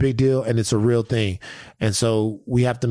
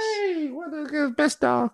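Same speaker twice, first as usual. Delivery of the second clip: dog voice; 4 dB quieter, slower than usual. Real, not acted. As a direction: gush at the puppy. Baby talk to dog.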